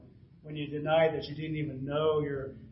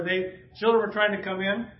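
usual speaking voice

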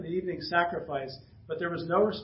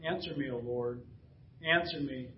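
softer, earnest plea to God; pleading prayer; 4 semitones lower, 7 dB quieter than usual. A narrator's reading. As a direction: pray quietly and humbly. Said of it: "stepped forward and prayed".

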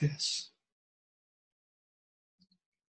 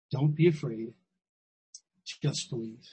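soft repeat; 4 semitones lower, 5 dB quieter than usual. Shown as a demonstration.

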